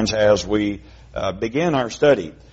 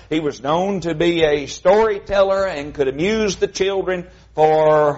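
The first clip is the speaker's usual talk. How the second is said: usual speaking voice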